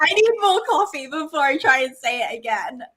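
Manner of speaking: real laughter running through the words; laughing. Real, not acted. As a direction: talk through laughing at your own tongue-tied tries. Both laughing over the tongue twister.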